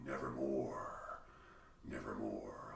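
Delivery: low, hushed nevermore with a hint of a raven; foreboding raven. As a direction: intone it lightly, low and ominous. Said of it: "all foreboding-like".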